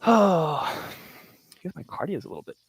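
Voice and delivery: really winded from push-ups; out of breath. Real, not acted. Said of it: "I'm out of breath".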